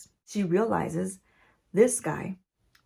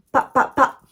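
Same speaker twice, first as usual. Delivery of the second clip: loud, higher popping gunshots; gunshot sound effects; 4 semitones higher, 8 dB louder, slower than usual. Shown as a demonstration. Recapping the show's shootout, voicing gunshots aloud. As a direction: mouth three quick gunshot pops. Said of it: "I do my sound effects".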